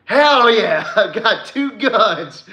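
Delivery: high, loud whoop breaking into laughter; laughing, over-excited mock yell; gleeful maniac. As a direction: holler it like a gun-happy maniac, laughing. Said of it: "he was a maniac, dude, about it".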